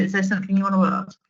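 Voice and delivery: usual speaking voice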